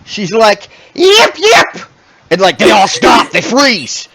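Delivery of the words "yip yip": sharp high yipping bark, loud; high barking yips. Acted. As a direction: bark it out like a little dog. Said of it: "she literally barks".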